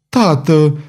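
a pleading call, pitch rising; heartbroken cry. A narrator's reading. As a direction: call to him in pain. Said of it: "strigă Violeta cu glas sfâșietor".

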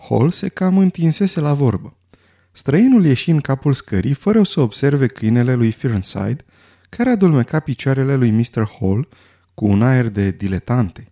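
usual speaking voice

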